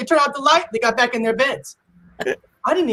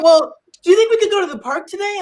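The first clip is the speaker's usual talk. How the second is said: put-on pleading kid; 7 semitones higher, faster than usual. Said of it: "They're like".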